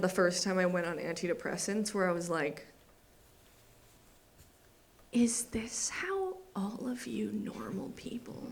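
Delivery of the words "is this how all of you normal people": slow, soft whisper of disbelief; hushed amazed whisper; awed whisper. Retold or imitated. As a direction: breathe it out in wonder. Awed realisation on lithium.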